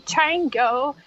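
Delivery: usual speaking voice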